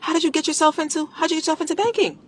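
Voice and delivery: lightly flattering interest; sweet curious question. Shown as a demonstration. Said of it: "You ask them".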